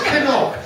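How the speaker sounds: "Cannock" echoed high in an affected, genteel way; affected refined echo. Retold or imitated. Comedian querying the woman's town, affectedly echoing "Cannock". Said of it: "Must have been Missus Bouquet".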